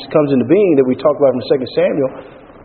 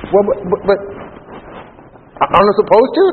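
higher-pitched, halting objection; mock stammering protest; 6 semitones higher than usual. Believer questioning God's instruction, mock hesitant. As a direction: stumble through a put-on feeble protest.

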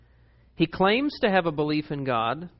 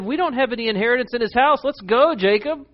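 daughters; 7 semitones higher, 5 dB louder than usual. Retold or imitated. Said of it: "They said".

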